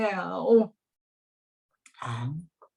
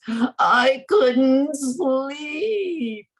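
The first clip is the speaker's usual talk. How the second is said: drawn-out, higher weary wail; whiny complaint; 6 semitones higher, 4 dB louder, much slower than usual. Acted. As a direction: stretch it out like a sleepless man begging. Isaac complains to rabbi.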